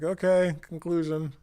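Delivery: comic battered-man voice; beaten-up experimenter. Acted. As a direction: state the lesson as the battered loser. Beaten-up experimenter states his conclusion.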